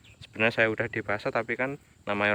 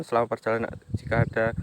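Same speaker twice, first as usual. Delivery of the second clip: normal talking voice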